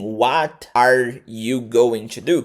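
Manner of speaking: "What are you going to do?" slowly, each word separate; careful full pronunciation. Shown as a demonstration. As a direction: spell out "going to" in full, very deliberately. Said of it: "você pode pronunciar assim".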